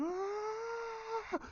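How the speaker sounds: long, very high strained scream; attacking cry held out high; battle scream. Retold or imitated. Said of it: "Kylo's in there going".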